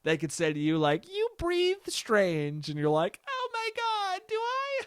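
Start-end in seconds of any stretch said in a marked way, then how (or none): 1.1-2.7 much higher, slow teasing coo; cutesy voice. 3.3-4.8 put-on very high, breathy-sounding baby voice; cutesy squeak.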